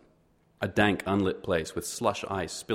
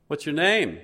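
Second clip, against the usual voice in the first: much higher, louder call after her; 9 semitones higher, 7 dB louder, slower than usual. A narrator's reading. Johann calling after the departing woman, loud and high. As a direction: shout it across the yard.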